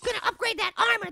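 ordinary talk

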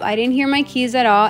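usual speaking voice

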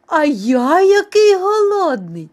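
high, loud, slow mouse wail; hungry wailing. A narrator's reading. Second mouse at the table, wailing with hunger. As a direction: outdo the other in hunger, drawn out.

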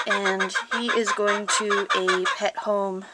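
ordinary delivery

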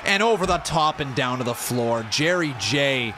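loud, excited wrestling commentary; live play-by-play. Acted. Streamer calls his wrestling match live, excited and loud. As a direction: call every move like a ringside announcer.

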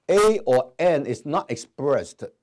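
慢读a or an is not expressed; 一词一顿地强调; slow emphatic reading. Shown as a demonstration. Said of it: "所以你看到啊". (慢速强调读)

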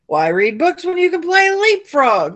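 frog voice. A narrator's reading. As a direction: push it up like an eager frog. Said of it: "croaked Francine".

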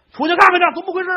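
high, loud startled order; startled old man. Acted. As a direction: send her out, alarmed.

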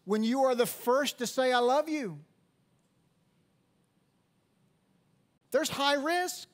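high, loud, driving preacher voice; full-force preaching. Acted. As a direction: preach it hard to the congregation. Sermon climbs to full preaching.

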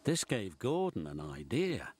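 ordinary delivery, nothing marked

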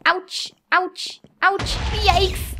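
short, high-pitched ouches; alarmed yelping. Real, not acted. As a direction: yelp at each hit, pitched high. Player is getting hurt in game.

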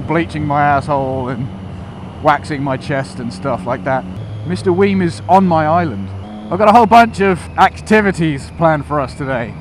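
ordinary delivery

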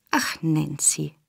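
slow, soft, drawn-out sigh; soothing. A narrator's reading. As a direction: placate her quietly and unhurriedly. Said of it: "sagte der Jude beschwichtigend".

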